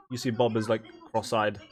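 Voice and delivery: ordinary delivery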